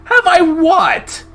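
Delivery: high, loud, drawn-out disbelief; shocked question. Acted. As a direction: squeak it out in disbelief. Chase startled by question.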